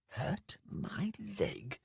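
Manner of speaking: slow, hushed, high-pitched incredulity; mouthed. A narrator's reading. Talon silently querying Beatrax's ruse, mouthing it. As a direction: barely voice it, stretched out in disbelief. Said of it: "mouthed Talon".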